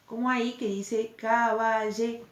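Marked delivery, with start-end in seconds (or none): 1.2-2.3 "caballería" slowly syllable by syllable, ca-ba-lle-rí-a; hiato con tilde. Shown as a demonstration. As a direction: split "caballería" so rí and a stand apart. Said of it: "le ponemos tilde". ("caballería" silabeado, con el hiato separado)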